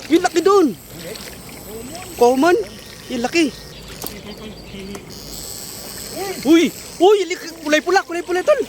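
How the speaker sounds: high, amazed exclamations and whoops; excited shouting. Real, not acted. Angler seeing big red fish, thrilled shouting. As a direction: shout in delight at a big catch.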